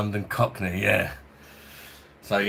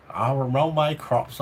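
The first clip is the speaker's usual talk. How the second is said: a bit higher, rolling West Country farmer voice; mock farmer accent; 6 semitones higher than usual. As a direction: ham up a Somerset farmer accent, half-joking. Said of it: "I couldn't imagine me talking like a farmer".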